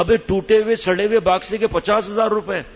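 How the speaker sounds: high, loud mocking scoff; husband brushing off the assistant, loud and high; scornful husband. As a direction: sneer at the price like a haggler.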